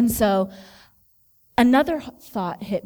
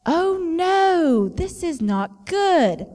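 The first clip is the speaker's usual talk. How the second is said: high, dismayed oh no, drawn out slowly; sudden dread on a steep path; 7 semitones higher, much slower than usual. Retold or imitated. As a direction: let the alarm rise in your voice, slowly. Realizing she is stuck.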